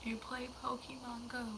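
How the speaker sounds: ordinary talk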